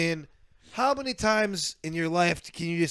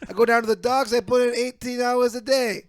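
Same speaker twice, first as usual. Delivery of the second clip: loud, higher mock Brooklyn working guy; Brooklyn accent; 5 semitones higher, 5 dB louder than usual. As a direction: plead your case as a hammed-up Brooklyn regular guy. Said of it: "he's from Brooklyn for some reason".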